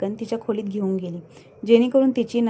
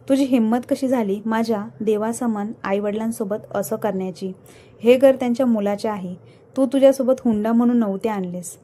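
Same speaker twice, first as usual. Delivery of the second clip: usual speaking voice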